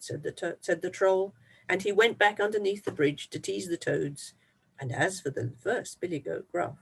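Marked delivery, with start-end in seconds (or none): none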